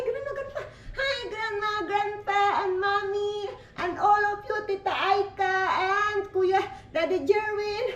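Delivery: put-on high, slowed baby voice greeting the family; be the little one saying hi to grandma and grandpa; mother voicing her baby for the viewers, high and giggly; speaking as the baby.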